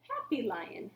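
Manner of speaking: ordinary delivery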